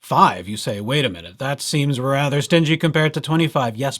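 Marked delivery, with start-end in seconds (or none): none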